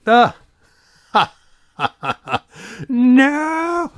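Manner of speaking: sudden yelp, high-pitched no; startled cry. Real, not acted. Something falls off the shelf, startled yelp. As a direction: caught off guard.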